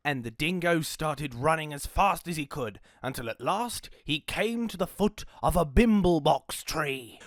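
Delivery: ordinary delivery, nothing marked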